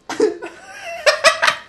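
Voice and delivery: very high squeal of laughter. Real, not acted. Laughing at the game.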